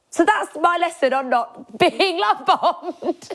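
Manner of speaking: high, slow, laughing through words; real laughter breaking the line. Real, not acted. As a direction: giggle through the moral. Laughing while summing up.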